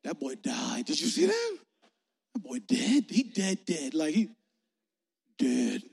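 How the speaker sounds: comic onlookers trading shocked remarks; hammed-up crowd reaction; murmuring crowd. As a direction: play several gossiping bystanders, pitch jumping about. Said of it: "a murmur ran through the crowd".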